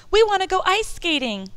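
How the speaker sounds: put-on high, loud eager kids shouting; excited shout. A narrator's reading. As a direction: shout it together like eager children. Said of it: "we all shouted together".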